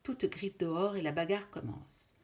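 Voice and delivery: ordinary delivery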